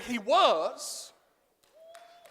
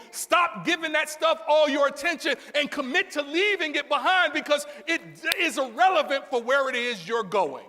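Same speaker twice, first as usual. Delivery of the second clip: raised, driving sermon delivery; full-force preaching; 3 semitones higher, 4 dB louder than usual. Acted. Preacher urging congregation to leave stuff behind, full-force preaching. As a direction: preach it in insistent rolling phrases. Preacher builds through repeated exhortations.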